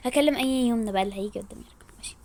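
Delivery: hushed, breathy scheming aside; whispered aside. Acted. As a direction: whisper it so no one hears the plan. Aside whispered between phone calls.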